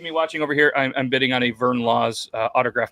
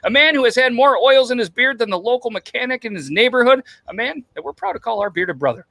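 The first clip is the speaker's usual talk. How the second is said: high, raised-voice hype build-up; announcer intro; 9 semitones higher, 5 dB louder than usual. Pretend. Host proudly introducing our bearded brother, announcer intro. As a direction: build toward the big reveal like an emcee.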